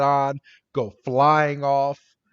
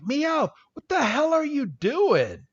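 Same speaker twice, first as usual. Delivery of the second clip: much higher, incredulous squawk; mock exasperation; 12 semitones higher than usual. Team Rocket confronts Meowth, high-pitched mock exasperation. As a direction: demand an answer in a high cartoon voice.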